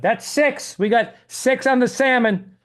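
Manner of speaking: high, slow waiter voice; lightly voiced ship waiter; crew member voice. Crew member quoted at sea.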